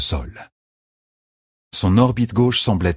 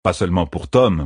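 usual speaking voice

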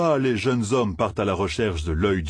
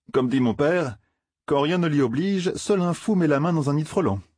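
voix haute de garçon qui veut se défiler; jeune farceur prudent; 8 semitones higher than usual. Acted. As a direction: propose la fuite d'un ton haut perché. (jeune Matt, voix aiguë)

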